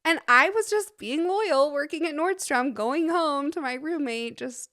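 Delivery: hammed-up self-pity; mock martyrdom. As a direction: play the loyal victim for laughs.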